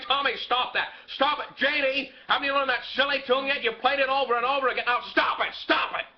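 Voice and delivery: put-on exasperated father snapping at the kids; irritated. Fed-up father yells at his children to stop the piano, irritated. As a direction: bark at them to stop.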